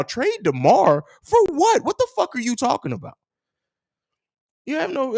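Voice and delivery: high, loud disbelief; shocked, indignant yelp; incredulous outburst. Real, not acted. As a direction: react as if the idea is absurd. Outraged at trade suggestion.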